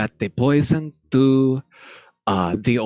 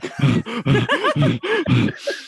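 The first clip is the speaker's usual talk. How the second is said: long high embarrassed giggle; nervous high-pitched laugh; high flustered giggle; 14 semitones higher than usual. Real, not acted. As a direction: giggle helplessly. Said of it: "I can't even control my voice".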